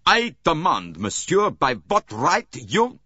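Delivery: bursting out at the detective in outrage; furious outburst. Acted. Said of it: "burst out furiously".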